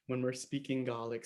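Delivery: normal talking voice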